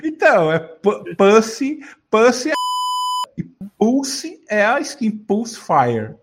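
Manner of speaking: slow, drawn-out "Pussy" with "â", then "Pulse" with "u"; exaggeratedly careful "Pulse Fire" after "Pussy"; demonstrating pronunciation. Shown as a demonstration. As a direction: teach "Pussy" against "Pulse", stretching each. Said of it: "É duas pronúncias diferentes".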